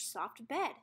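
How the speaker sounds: usual speaking voice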